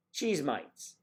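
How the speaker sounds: a light mock Australian accent on cheese mites; lightly put-on Australian accent. Mock Australian accent to a chat viewer.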